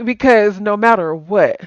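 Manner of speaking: usual speaking voice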